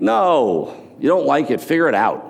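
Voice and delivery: firm retort. Retold or imitated. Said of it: "I'm like".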